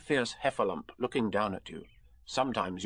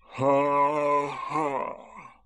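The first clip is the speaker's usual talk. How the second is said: slow, hollow mock laugh; bitter; 2 semitones higher than usual. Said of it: "said Eeyore bitterly".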